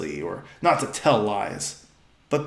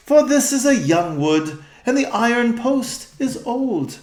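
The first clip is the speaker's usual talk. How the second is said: higher, louder stately queen; regal old-fashioned speech; 9 semitones higher, 3 dB louder than usual. Acted. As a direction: speak as a noble queen of old. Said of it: "their voice has changed".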